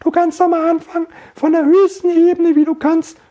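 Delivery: very high demo voice; squeaky top-of-range voice; highest pitch. Shown as a demonstration. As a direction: start at the top of your range. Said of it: "wie hoch und wie tief du kommen kannst".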